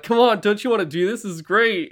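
egging them on with manic delight; manic glee. Retold or imitated. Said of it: "going like".

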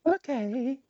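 high, squeaky character voice; high caller voice. Acted. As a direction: play a silly caller. Plays caller Monotony.

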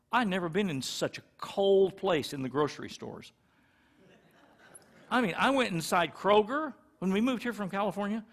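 normal talking voice

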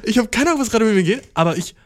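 high and loud, wired; hyper. Real, not acted. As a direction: babble like you can't sit still. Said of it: "Was ist mit mir los".

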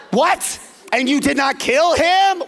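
high, loud disbelieving yell; outraged shout. Retold or imitated. Saul yelling at his troops who came back empty-handed, outraged. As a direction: explode in disbelief.

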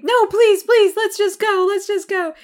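put-on high, loud, pleading little-girl whine; whiny begging. Tresselaine begging Beckett to leave the mall, high whine.

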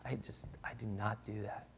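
soft, self-reproaching mock confession; condemned moralist. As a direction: sigh it out, acting mildly weighed down by guilt. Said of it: "look at them and feel condemned".

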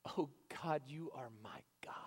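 a whispered, intimate prayer to God; hushed psalm. Acted. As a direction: breathe the prayer almost silently. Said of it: "When David says".

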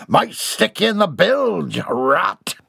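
high, slow pirate threat ending in a cackle; menacing pirate. Acted. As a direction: threaten the stowaway and laugh at him.

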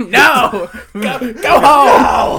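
loud, high, drawn-out shout; mock shout. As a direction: chase them away in joking outrage.

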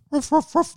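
high loud ruffs; dog barks. Acted. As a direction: bark like a big friendly dog. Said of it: "he would come".

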